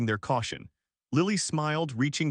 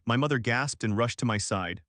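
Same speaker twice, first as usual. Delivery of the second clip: ordinary delivery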